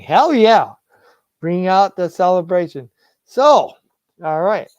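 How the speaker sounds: celebrating a Lions win, loud whoop. Real, not acted. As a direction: cheer it out like a win.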